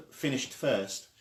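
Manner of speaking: ordinary delivery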